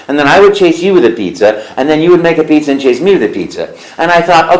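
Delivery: ordinary talk, nothing marked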